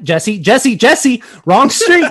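mounting mock alarm, climbing high; retold panic. Passenger warning cousin about wrong street, retold panic. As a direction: re-enact an urgent warning, drawing out the last words.

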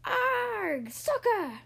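high pirate war cry; high, drawn-out pirate cry; pirate yell. A narrator's reading. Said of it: "clicked the ball and yelled".

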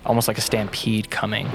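ordinary delivery, nothing marked

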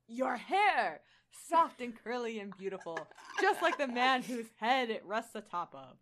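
lightly Australian Thor, laughing at the end; a touch of an Australian accent, admiring. Acted. Reader voicing Thor in a faint Australian accent. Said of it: "still attempting it".

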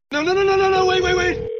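high desperate cries of no; frantic screaming. Acted. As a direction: beg them not to hang up. Desperate as the line drops.

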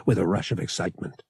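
plain reading voice

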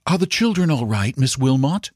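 high voice of a worried mother; anxious mother. A narrator's reading. Mother phoning the governess.

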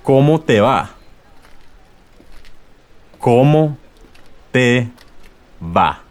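"¿Cómo te va?" then split word by word; model then syllables. Shown as a demonstration. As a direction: break "¿Cómo te va?" into pieces. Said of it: "How does it go for you?".

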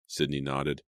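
ordinary delivery

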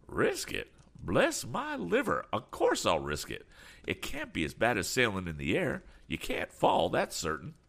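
excitable voice with high-pitched questions; eager eccentric. Acted. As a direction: ask eagerly to come along. Damon insists on going.

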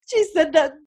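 high, quick imitation of tearful laughing; laughing and crying. Daughter recalling grandma's boast at the graveside, imitated laughing and crying. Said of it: "She's laughing and crying at the same time".

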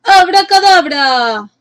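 high, slow magic shout; drawn-out witch's incantation; shouted spell. A narrator's reading. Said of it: "la agitó y gritó".

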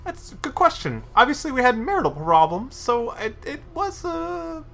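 high, nasal-free nobleman voice pitched well up; Magister voice. Acted. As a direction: voice the flustered Magister high above your own.

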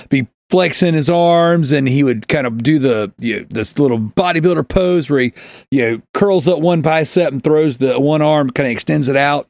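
ordinary talk, nothing marked

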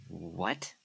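soft, low questioning gasp; hushed shock. Acted. Woman hearing of a murder, quietly stunned.

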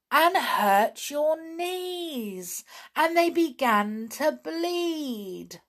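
slow, high, drawn-out lament; woeful recounting. A narrator's reading. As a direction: dwell dramatically on the poor knees. Pixie recounting a painful fall.

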